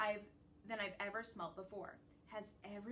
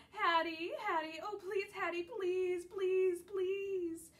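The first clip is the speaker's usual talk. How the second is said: high, louder whine of please; whining plea; 10 semitones higher, 7 dB louder, slower than usual. A narrator's reading. Dog whining at Hattie for supper, high pleading whine. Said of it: "I whine some more".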